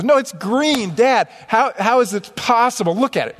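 daughter's voice. Retold or imitated. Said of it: "She goes".

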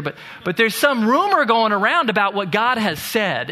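normal talking voice

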